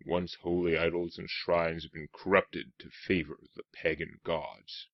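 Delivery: hint of an ominous storyteller; attempted game narrator. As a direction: try a measured, portentous narrator read. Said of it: "Haven't got that narrator's voice yet".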